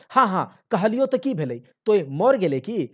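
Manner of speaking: loud, high dismissive retort; husband scoffing loudly at wife; scornful retort. Acted. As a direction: brush her off, loud and scornful.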